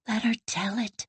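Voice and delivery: soft, hushed aside; a quick hushed plea to her friend; whispered. A narrator's reading. Ilse urges Emily under her breath to let the old woman talk. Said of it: "whispered Ilse".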